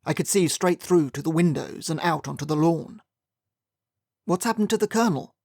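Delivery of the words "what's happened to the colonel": surprised. A narrator's reading. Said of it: "I asked".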